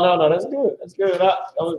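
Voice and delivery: usual speaking voice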